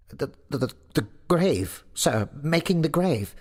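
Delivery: high, stuttering sexton; frightened stammer. A narrator's reading. Sexton explains his work to the goblin, stammering. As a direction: stumble over the first word in a high voice. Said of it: "stammered the sexton".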